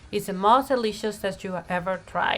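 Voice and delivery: usual speaking voice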